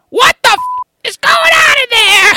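high, loud yell from offstage; high shouted wife. Retold or imitated. The wife shouting from another room, high and loud. Voicing wife yelling in background.

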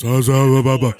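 loud mocking gibberish; mocking blah blah. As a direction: brush it off as noise, mockingly. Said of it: "All I hear is".

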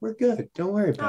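gently calm an anxious friend; soothing reassurance. Retold or imitated. Retells hugging and calming teammate.

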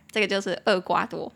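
ordinary delivery

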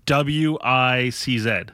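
slow letter-by-letter spelling. Real, not acted. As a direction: spell each letter slowly. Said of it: "Say it, say it again".

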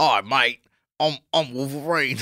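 hammed-up Australian bloke; Australian accent. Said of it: "Wolverine sounded Australian as hell".

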